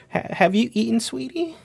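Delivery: high, doting grandma voice; old woman. Pretend. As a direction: fuss over them like a granny. Grandmother patron checking in.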